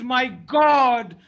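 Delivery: desperate shouted lament to God; anguished prayer. Acted. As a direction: wail it up to heaven. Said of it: "all we can say is".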